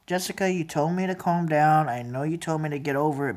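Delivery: holding back tears, barely voiced; quiet hurt. Real, not acted. Speaking to a relative she resents, quiet and hurt.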